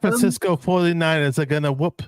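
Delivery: badly faked posh British accent. Shown as a demonstration. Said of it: "it's a really bad British accent".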